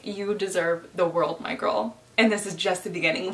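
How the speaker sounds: ordinary delivery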